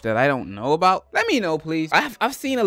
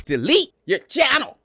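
punchy, higher read-out; mock shouted comment; 7 semitones higher, 3 dB louder than usual. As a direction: read the hate comment like a command. Reading a hate comment.

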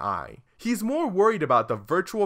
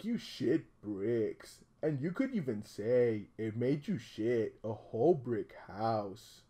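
soft, slow, low storytelling; hushed, slow creepy fairy-tale telling; creepy bedtime story; 3 semitones lower, 5 dB quieter than usual. Pretend. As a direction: read it like a sinister lullaby. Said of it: "bedtime story".

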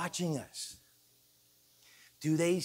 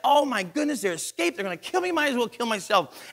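acted panic, raised pitch and volume; panicked jailer; 7 semitones higher, 7 dB louder than usual. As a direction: play the alarmed jailer, loud and higher.

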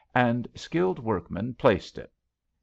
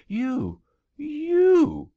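rising, louder stunned cry; stunned disbelief; 6 dB louder than usual. A narrator's reading. King stunned to find Billee there, crying out in disbelief.

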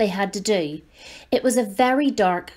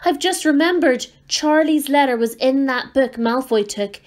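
rising panic, higher and louder; panicked; 6 semitones higher, 4 dB louder than usual. Acted. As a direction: blurt it out in alarm. Ron panics, remembers letter.

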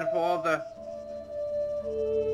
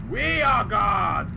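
proclaiming; 7 semitones higher, 3 dB quieter, much slower than usual. Acted. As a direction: declare it grandly.

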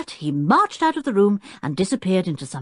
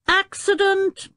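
a higher, slow mock bellow; slower than usual. A narrator's reading. Tinker bellowing after crashing into the wall. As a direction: call it out like a crash alarm. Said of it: "bellowed Tinker".

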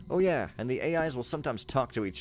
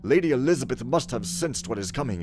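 raised, loud theatrical reading voice; dramatic letter reading; 3 semitones higher, 5 dB louder than usual. Acted. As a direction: read the letter like a stage messenger. Reads in-game letter and text dramatically.

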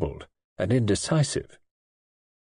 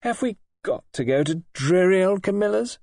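rebellious; 9 semitones higher than usual. A narrator's reading. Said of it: "Kay said rebelliously".

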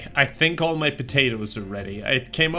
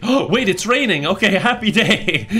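excited; 7 semitones higher, 5 dB louder than usual. Real, not acted. Excited at rain.

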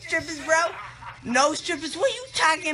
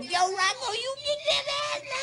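retold yell; 6 semitones higher than usual. Retelling his yell to Rocco.

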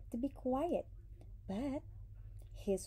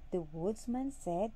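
ordinary delivery, nothing marked

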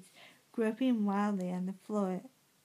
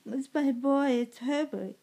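higher, louder, urgent plea; narrated cry; 5 semitones higher, 5 dB louder than usual. A narrator's reading. Mother tells her husband it's her boy, raised urgent cry. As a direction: cry it out, a little restrained. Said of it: "she cried".

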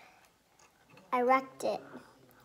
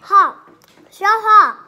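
loud exclaim; 4 semitones higher, 13 dB louder, slower than usual. Real, not acted. Reacting to spicy food.

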